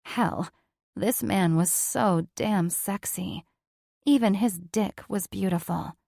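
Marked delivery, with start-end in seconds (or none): none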